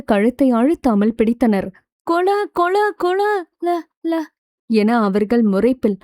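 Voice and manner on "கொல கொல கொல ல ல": high, choked-off syllables, hammed up; pretend strangled voice. A narrator's reading. Girl play-acts being strangled by her friends, high mock choking. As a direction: act as if being throttled, breaking the word up. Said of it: "கழுத்தை நெரித்தது போலவே லேசாக இருமியபடி நடித்தவள்".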